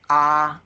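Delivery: "Ah" held high and level, loud; sustained high, even "Ah"; first tone. Shown as a demonstration. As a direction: hold "Ah" at the top of your pitch. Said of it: "The first tone is high pitch".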